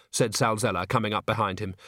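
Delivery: usual speaking voice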